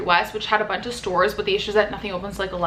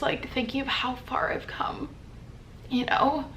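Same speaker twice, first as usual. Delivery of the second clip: tearful; 3 semitones higher, 6 dB quieter than usual. Real, not acted. Emotional reflection, whispered.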